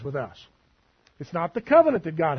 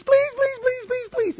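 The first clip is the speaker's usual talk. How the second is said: very high pleading whine; whiny begging. Retold or imitated. Preacher mimics the enemy's begging, high whiny plea. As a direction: wheedle in a shrill begging voice. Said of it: "it's the enemy".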